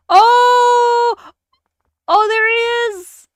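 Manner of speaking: high, loud drawn-out oh; loud, high shock, stretched out; real startled cry. Real, not acted. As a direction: cry out at a sudden creepy sight. Startled by a creepy scene.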